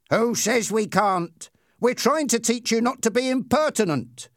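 high-pitched, scornful retort; high, self-righteous bullying; bully, defiant. Acted. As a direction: lecture the victim like a young tough. Bully answers the victim.

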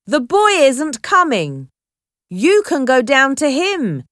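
carrying, high-pitched call; calling out loudly. A narrator's reading. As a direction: shout the news up to the deck. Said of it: "he called loudly".

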